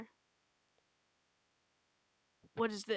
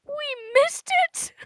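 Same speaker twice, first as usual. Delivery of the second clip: much higher, louder, slow drawn-out cry; stretched despairing shout; horrified wail; 12 dB louder, much slower than usual. Acted. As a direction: wail it in dismay. Horrified realisation.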